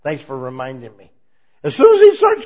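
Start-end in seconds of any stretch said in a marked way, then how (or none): 1.6-2.4 high, loud sudden outburst; excited burst. Real, not acted. Preacher bursting out to the congregation, high and loud.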